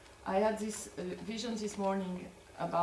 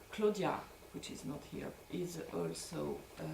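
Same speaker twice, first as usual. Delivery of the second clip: normal talking voice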